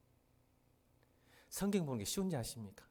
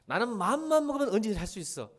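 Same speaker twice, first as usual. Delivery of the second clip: boastful quote; 6 semitones higher, 5 dB louder than usual. Retold or imitated. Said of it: "저도 객기를 부려본 적이 있어요".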